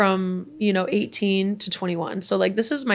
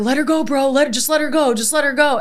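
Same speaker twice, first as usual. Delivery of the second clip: mildly urgent retold plea, pitched up; higher, insistent mock pleading; 5 semitones higher, 4 dB louder than usual. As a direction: imitate a friend pressing someone to back off. Passenger urging driver, retold.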